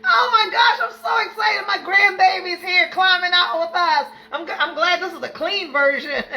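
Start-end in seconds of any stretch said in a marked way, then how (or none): 0.1-4.1 high, loud and laughing; thrilled. Real, not acted. Grandmother thrilled her grandbaby joined, high and loud. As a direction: gush with real excitement.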